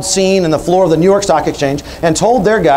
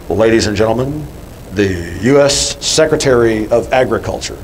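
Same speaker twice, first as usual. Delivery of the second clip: low, dull TV announcer; boring announcer voice; 7 semitones lower than usual. Retold or imitated. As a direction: drone it out like a bored TV host. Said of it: "there's a really boring voice comes on television".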